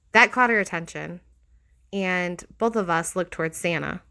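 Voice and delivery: plain reading voice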